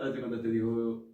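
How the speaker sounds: ordinary talk, nothing marked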